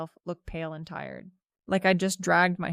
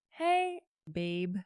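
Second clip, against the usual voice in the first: croaky weak voice. A narrator's reading. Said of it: "I croaked".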